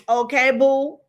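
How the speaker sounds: a hint of a Gambian chick's okay boo. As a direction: say it like a girl from Gambia, breezy. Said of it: "when a chick in Gambia goes".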